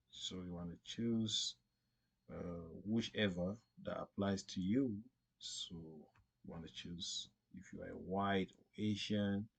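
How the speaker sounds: usual speaking voice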